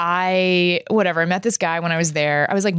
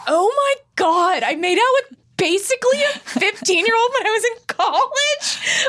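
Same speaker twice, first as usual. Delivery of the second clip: horrified laughing. Retold or imitated. Her own horrified reaction retold.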